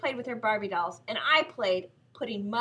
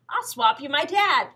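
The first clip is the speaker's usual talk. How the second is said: excited; 4 semitones higher, 8 dB louder than usual. A narrator's reading. Boy offers his big idea.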